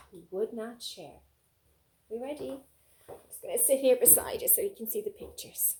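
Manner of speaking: usual speaking voice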